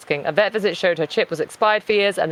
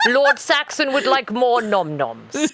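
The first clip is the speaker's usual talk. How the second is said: slow, higher posh documentary narration; David Attenborough; 4 semitones higher, slower than usual. Acted. Narrating the cat wanting food, posh documentary voice. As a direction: narrate slowly and grandly, holding back laughter. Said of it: "I'm David Attenborough in this".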